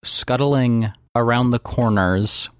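low, even scene-setting voice; game master narration. Acted. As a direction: set an eerie scene, low and measured.

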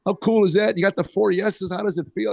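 high-pitched, hyped-up cheering; Terry Crews congratulating. Retold or imitated. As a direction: gush like an overjoyed buddy in a high voice. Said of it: "he's hugging me".

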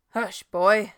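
gruff and loud hush. A narrator's reading. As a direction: shut the boy up gruffly.

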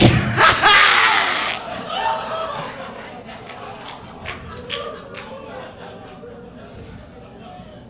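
high breathless laugh; helpless wheezing laughter. Real, not acted. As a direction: cackle without voice. Laughing after the joke.

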